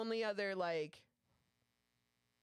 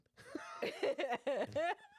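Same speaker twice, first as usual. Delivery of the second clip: laugh; 10 semitones higher than usual. Real, not acted. Laughs at own story.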